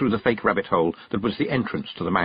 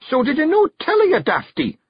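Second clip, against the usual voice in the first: much higher, louder Scottish telling-off; exasperated scold; 5 dB louder than usual. Acted. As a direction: snap at a dafty. Rob scolds Big Yan.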